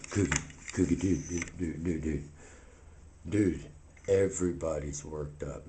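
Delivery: very low, soft mumbling voice; low laid-back character. Acted. Cookie talking down a worked-up cast, low and mellow. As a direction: sink way down and talk quietly.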